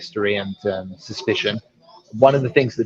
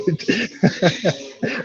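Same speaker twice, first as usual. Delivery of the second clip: high-pitched laugh; 8 semitones higher, 3 dB quieter than usual. Real, not acted. Teasing co-host about lollipop.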